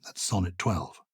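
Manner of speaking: normal talking voice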